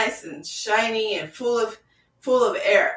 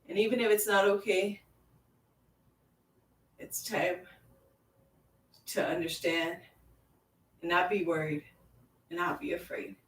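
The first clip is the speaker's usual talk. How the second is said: soft reassurance; 3 semitones lower, 8 dB quieter than usual. Acted. Calming guided relaxation, voice fading.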